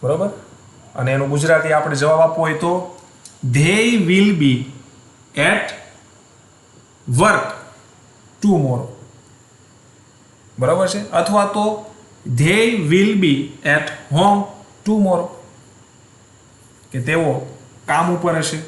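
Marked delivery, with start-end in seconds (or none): 3.5-8.9 very slow, word by word, with pauses between; slow dictation. Shown as a demonstration. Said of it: "teacher dictates English answer". 12.3-15.3 very slow, word by word, with pauses between; slow dictation. Shown as a demonstration. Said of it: "teacher dictates alternative answer".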